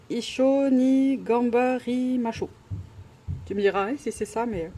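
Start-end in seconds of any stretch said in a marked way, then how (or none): 0.1-2.5 slow, halting Japanese in a French accent; clumsy Japanese accent. Real, not acted. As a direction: sound out unfamiliar Japanese syllable by syllable, French-accented. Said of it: "je suis désolée avec mon accent". (japonais hésitant, accent français)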